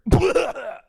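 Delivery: loud high burst of laughter; dad's laugh. Retold or imitated. Drunk dad waking up, sudden loud laugh. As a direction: let out a sudden loud guffaw. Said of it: "all of a sudden I hear".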